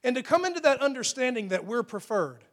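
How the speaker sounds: ordinary delivery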